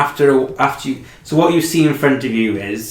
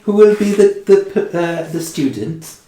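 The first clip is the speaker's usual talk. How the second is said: receptionist voice; 8 semitones higher than usual. Acted. Receptionist, high.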